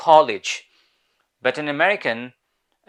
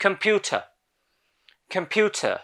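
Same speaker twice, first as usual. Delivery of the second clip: British "Computer", higher, final r dropped; British pronunciation; 6 semitones higher, much slower than usual. Shown as a demonstration. Teacher repeats the word for learners, British accent. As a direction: drill it in a British accent, clear t. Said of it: "British ইংলিশে রটাকে উচ্চারণ করা হয় না".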